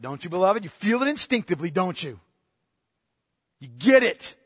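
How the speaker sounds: ordinary talk, nothing marked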